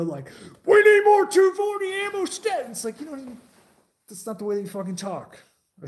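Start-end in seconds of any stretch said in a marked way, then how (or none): 0.6-2.7 high, loud mock panic into the radio; mock radio scream. Mocking trainees screaming into the radio, loud mock yell. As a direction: yell it like a movie soldier on the radio. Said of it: "like, screaming in the radio".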